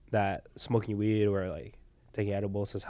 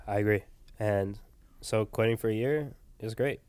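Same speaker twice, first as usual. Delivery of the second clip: ordinary talk, nothing marked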